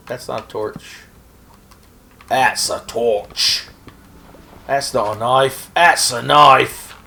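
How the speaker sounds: hammed-up Australian accent, dragging the punchlines; a rough, lightly done Australian accent; Paul Hogan Australian accent. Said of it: "It was just a shitty Australian accent".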